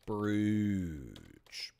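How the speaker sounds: brooch in Canadian, said broosh with oo; Canadian oo in brooch, ending sh. Shown as a demonstration. Showing the Canadian way, brooch as broosh. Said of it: "Canadian pronunciation of".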